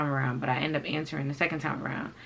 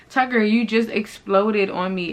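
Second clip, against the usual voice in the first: higher, louder protest; indignant confrontation; 5 semitones higher, 6 dB louder than usual. Retold or imitated. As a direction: call him out on his temper. Retelling her confrontation with him.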